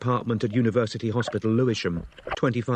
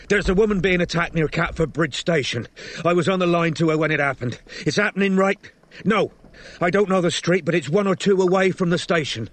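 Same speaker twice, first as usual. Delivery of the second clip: high, raised voice reporting an emergency; urgent yelling; 7 semitones higher, 3 dB louder than usual. A narrator's reading. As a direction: yell urgently into the phone for help. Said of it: "Strike yelled into the boy's phone".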